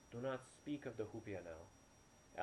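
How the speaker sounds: slow, firm and measured warning. Acted. Midwife Elena firmly warns the doctor not to speak of it, slow firm warning. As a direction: lay down the rule slowly, no arguing. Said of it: "Elena said firmly".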